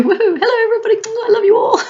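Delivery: very high, loud bouncy clown voice; squealing high clown hello to the room; exuberant clown greeting. Retold or imitated. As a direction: burst in like a giddy clown. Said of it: "bouncing as I do".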